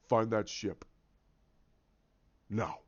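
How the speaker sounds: very low, controlled villain voice; villain's command. Acted. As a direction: order the crew in a deep, menacing undertone. Villain giving an order.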